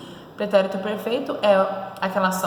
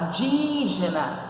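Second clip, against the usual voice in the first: indígena with a long DÍ; stressed syllable stretched; slower than usual. Shown as a demonstration. Teacher pointing out the tonic syllable, indígena with DÍ drawn out. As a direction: hold the DÍ in indígena, slowly. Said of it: "Qual é a posição?".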